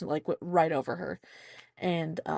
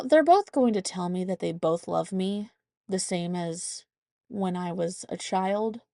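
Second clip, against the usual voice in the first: ordinary talk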